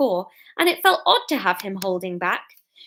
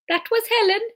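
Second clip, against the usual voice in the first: very high-pitched Geordie attempt; botched Geordie accent; 12 semitones higher than usual. Narrator retries Norman's line, overshot Geordie. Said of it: "No, hang on a minute".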